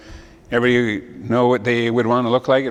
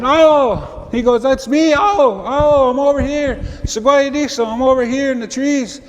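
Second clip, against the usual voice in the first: high, bright calling owl voice; eager owl calling from the branches; excited owl; 12 semitones higher, 4 dB louder than usual. Acted. Owl calls down to the creator, high and eager.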